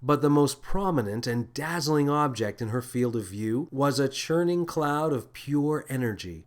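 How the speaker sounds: ordinary delivery, nothing marked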